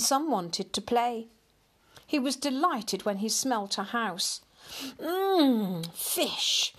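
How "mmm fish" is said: higher, savouring "mmm"; sniffing with relish. A narrator's reading. Cat delighted at the smell of a house, relishing. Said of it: "sniffed Hamish".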